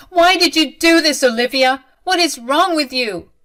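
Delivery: loud, high-pitched outraged demand; upset confrontation. A narrator's reading. Distraught accusation in a quarrel.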